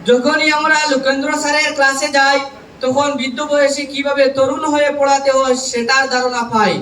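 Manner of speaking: loud, measured public recitation of verse; poem recitation. Acted. Trainee reciting a farewell poem to college teachers, declaimed loudly. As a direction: declaim rhymed tributes line by line, pausing between phrases.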